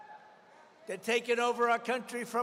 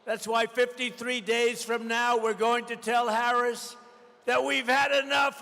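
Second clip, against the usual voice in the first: ordinary talk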